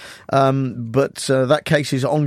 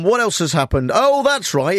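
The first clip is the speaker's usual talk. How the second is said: ordinary talk, nothing marked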